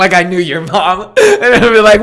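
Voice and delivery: high, laughing through the words. Real, not acted. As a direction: talk through a fit of laughter. Joking he knew the other's mom.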